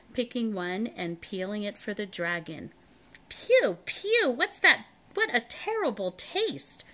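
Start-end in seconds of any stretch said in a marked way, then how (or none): 3.3-6.8 high, loud disgusted sputter; choking in disgust. A narrator's reading. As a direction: spit the words out like something foul. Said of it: "choked the dragon".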